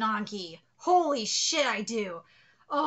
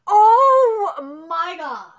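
drawn-out loud cry of disbelief; a slow, loud oh my god, undone by the art; overwhelmed cry; 4 semitones higher, 12 dB louder, much slower than usual. Real, not acted. Overwhelmed by the fan art, a slow loud cry.

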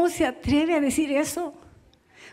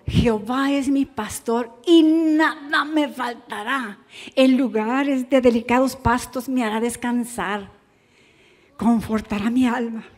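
reverent recitation; 3 dB quieter than usual. Shown as a demonstration. Reciting Psalm 23.

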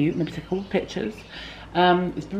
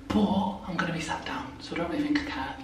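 whisper; 6 dB quieter than usual. Real, not acted. Aside about short dress.

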